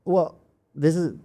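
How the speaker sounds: ordinary talk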